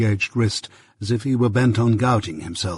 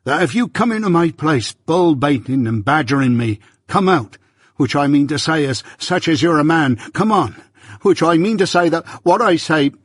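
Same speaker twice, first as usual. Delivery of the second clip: rising higher and louder, blacksmith squaring up; indignant challenge; 5 semitones higher, 3 dB louder than usual. A narrator's reading. Joe challenging Jaggers in his own forge, indignant and louder. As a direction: challenge him to come out and fight. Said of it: "cried Joe".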